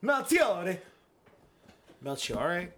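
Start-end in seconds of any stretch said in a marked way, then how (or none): 0.0-0.8 high, loud yell of the name; showy shout, pitched way up; gleeful yell. Shown as a demonstration. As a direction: belt out the name for fun. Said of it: "mad fun to fucking yell".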